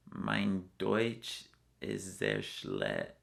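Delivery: slow, deliberate German words; careful German. Shown as a demonstration. Traveler put on the spot speaks German slowly. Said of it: "Can you say anything?".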